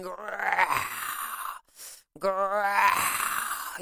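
mock growl like a lion. Lion showing the travelers he is fierce, a mock growl. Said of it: "you know, like a lion".

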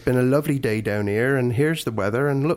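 West Country small talk; imitated Somerset and Dorset speech; Dorset accent. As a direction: chat in a rustic Dorset accent. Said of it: "So they talk like that".

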